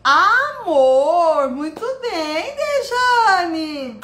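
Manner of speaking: loud, high, drawn-out cheer; excited praise. Real, not acted. Teacher praising a participant's answer, excited and high. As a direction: celebrate the answer, stretching the first vowel.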